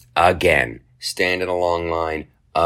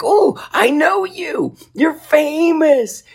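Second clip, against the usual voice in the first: very high, slow gushing fan voice; a starstruck fan squealing it slowly; lady at the counter; 5 dB louder, much slower than usual. Retold or imitated. Customer service lady recognizing the comedian, in a very high gushing voice. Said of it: "She's like".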